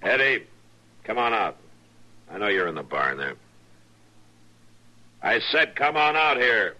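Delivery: loud call toward the barn. Acted. Marshal calling the boy out of the barn, loud. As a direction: call the hiding boy out firmly.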